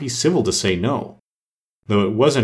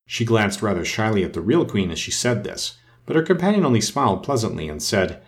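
plain reading voice

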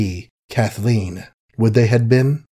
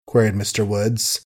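ordinary delivery, nothing marked

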